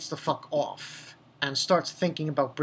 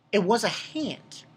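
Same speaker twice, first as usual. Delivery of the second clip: ordinary delivery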